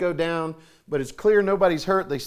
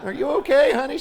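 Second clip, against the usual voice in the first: high, loud, through a laugh; wife giggling at her fallen husband; 9 semitones higher, 7 dB louder than usual. Retold or imitated. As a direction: ask it while laughing at him. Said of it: "She said".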